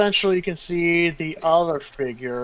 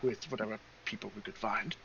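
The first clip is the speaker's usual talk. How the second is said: lightly voiced, much softer halfling; halfling companion; 4 semitones lower, 9 dB quieter than usual. Halfling tells of their escape, soft and hesitant. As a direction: explain hesitantly in a quiet, low-key voice.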